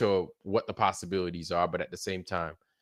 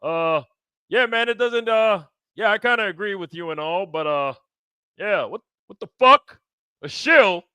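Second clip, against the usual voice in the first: high, put-on voice of a friend, friendly then incredulous; hammed-up friend, cheerful turning indignant; imitating Andrew; 8 semitones higher, 4 dB louder than usual. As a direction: play him casual, then flare up in disbelief. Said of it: "Andrew popped his head in".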